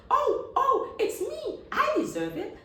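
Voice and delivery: high, loud, laughing claim; shrill eager grab for the prize; squabbling child. Acted. One son arguing he deserves the light, high and loud.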